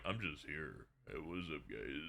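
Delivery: low, soft, bored mumble; lazy, quiet imitation of a friend; Jordan impression. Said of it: "And Jordan's like".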